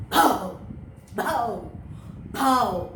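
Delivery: bow bow dog bark. Shown as a demonstration. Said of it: "And what does it say?".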